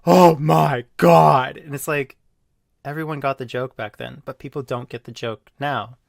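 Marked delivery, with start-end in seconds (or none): 0.1-1.6 loud, slow outraged voice; scandalized gasping. Retold or imitated. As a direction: clutch your pearls, loud and slow. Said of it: "people are like".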